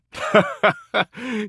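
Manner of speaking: high, quick run of laughter; amused laugh. Acted. Character laughing at someone they find funny, high laugh.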